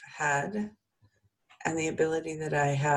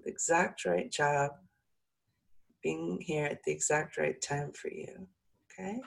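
calm soothing voice; 2 semitones higher than usual. Acted. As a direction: ease them into calm. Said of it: "let my voice calm you and soothe you".

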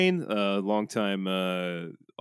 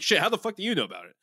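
surprised; 8 semitones higher, faster than usual. Retold or imitated. Own shocked reaction retold.

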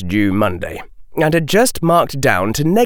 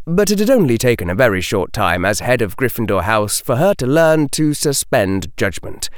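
usual speaking voice

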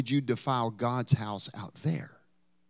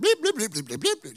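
higher-pitched rattled-off bleeps; mock cussing, bleeped out in a rapid run; censored cussing; 8 semitones higher, 3 dB louder than usual. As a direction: imitate a string of swearing with bleeps. Imitates censored cussing across street.

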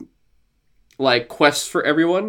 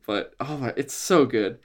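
ordinary delivery

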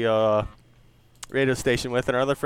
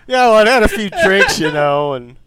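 louder, higher, laughing mock excuse to the rental clerk; drunk excuse; 5 semitones higher, 8 dB louder than usual. Prankster blaming the rental clerk, loud laughing mock excuse. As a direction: ham up a tipsy guy blaming the clerk.

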